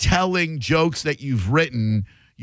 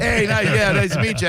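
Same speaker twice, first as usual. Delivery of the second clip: high, eager mock hello; high-pitched hammed-up meet-and-greet; pretend fan greeting; 9 semitones higher than usual. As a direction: play an over-friendly backstage greeting. Acted greeting to germaphobe star.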